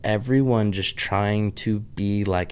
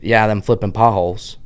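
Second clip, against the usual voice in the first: a light Pittsburgh accent, louder; 7 dB louder than usual. Shown as a demonstration. Vlogger demoing her Pittsburgh anchor line, light Pittsburgh accent. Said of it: "as my entry line to my Pittsburgh accent".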